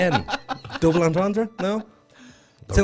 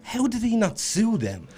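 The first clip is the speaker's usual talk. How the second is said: incredulous; 9 semitones higher, slower than usual. Retold or imitated. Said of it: "I'm like".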